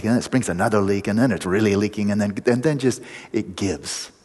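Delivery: ordinary delivery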